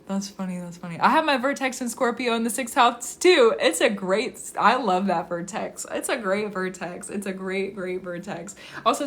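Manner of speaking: ordinary delivery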